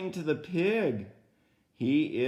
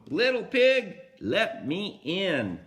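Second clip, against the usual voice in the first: big bad wolf; 5 semitones higher, 5 dB louder than usual. Storyteller voicing the wolf to the first pig, calling loudly. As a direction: call out like the wolf at the door.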